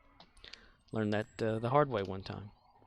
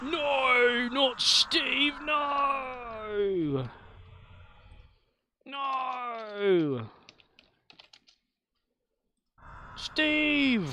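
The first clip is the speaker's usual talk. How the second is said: squeaky meerkat panic shout; 10 semitones higher, much slower than usual. Retold or imitated. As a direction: call out frantically, much higher than usual. Imitating the meerkat sketch shouting.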